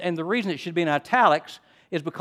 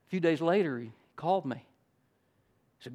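ordinary talk

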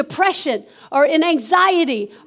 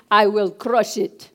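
low, slow Russian voice; Russian accent; 8 semitones lower, slower than usual. Acted. Preacher declaring war on sickness, Russian accent. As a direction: vow it slowly in a deep Russian accent. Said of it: "say it with your Russian voice".

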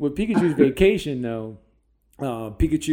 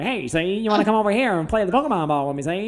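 put-on fast, higher, old-time New York gangster accent; New York caricature from the twenties, quick and pitched up; 1920s New York accent; 6 semitones higher, 3 dB louder, faster than usual. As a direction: do a cartoon New York tough guy, see. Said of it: "talks with a New York accent".

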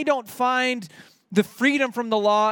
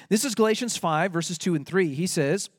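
normal talking voice

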